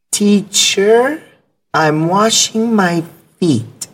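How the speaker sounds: put-on young Chinese student answering his teacher; eleven-year-old student. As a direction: talk like a little boy, slowly. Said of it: "this kid named Barry".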